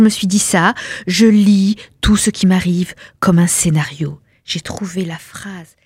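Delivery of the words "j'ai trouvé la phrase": very soft whisper. A narrator's reading. Narrator admiring a sentence, hushed whisper. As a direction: murmur it dreamily.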